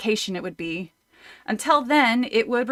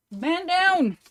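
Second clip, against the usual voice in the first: raised mock army call; soldier shout; 7 semitones higher than usual. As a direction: call it like a soldier under fire.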